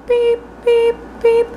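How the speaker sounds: imitating a shrill alarm beep; high sharp beeps like a smoke detector; high-pitched beep. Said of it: "a really high-pitched beep".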